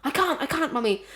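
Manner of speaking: put-on high-pitched desperate little boy; child's whine. Boy pleads with mummy on the plane, high whine. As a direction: squeal it like a kid who can't hold it. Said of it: "and he goes".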